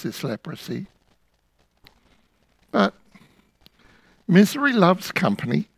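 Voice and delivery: ordinary talk, nothing marked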